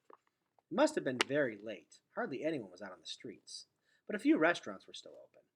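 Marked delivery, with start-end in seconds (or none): none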